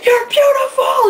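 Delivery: high, loud, slow shout; fan calling out. Retold or imitated. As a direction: yell it across the crowd.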